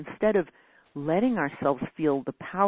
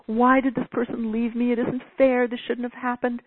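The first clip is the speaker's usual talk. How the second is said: mock self-pity; 7 semitones higher, 4 dB louder than usual.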